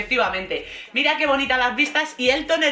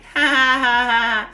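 mock nervous cackle like Peter Griffin; put-on run of nervous ha-ha-ha; imitated nervous laugh; 3 dB louder than usual. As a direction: imitate a silly stuttering giggle. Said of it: "es que se ríe así".

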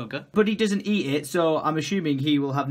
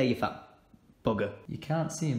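ordinary delivery

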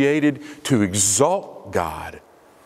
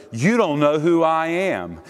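higher-pitched, emphatic challenge; self-important challenge; 5 semitones higher than usual. Acted. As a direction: pull rank, leaning on "don't". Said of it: "who thinks he's the greater".

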